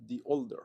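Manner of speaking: put-on "the older" with "the" said as "di"; "the" as "thee" before a vowel; English pronunciation demo. Shown as a demonstration. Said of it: "empieza con un sonido de vocal".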